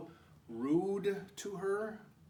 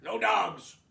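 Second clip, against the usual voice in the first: 1 stern repeat; 6 semitones higher, 8 dB louder than usual. Acted. Hank repeats order.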